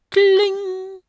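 very high, loud chime; piercing high imitated ding; strange ringing kling. A narrator's reading. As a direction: ring it out like a struck bell. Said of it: "ertönte ein seltsames".

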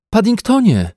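high, loud cry of the name; sharp rise in pitch and volume; alarmed exclamation. A narrator's reading. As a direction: cry out to stop the bear. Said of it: "wykrzyknęła pani Brown".

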